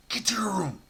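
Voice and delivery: a terse whispered command; whispered order. Retold or imitated. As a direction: whisper it so the guards won't hear. Inmate quietly sets up fight.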